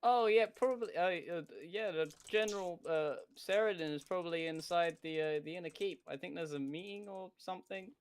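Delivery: high, bright NPC guard; eager helpful guard voice. Acted.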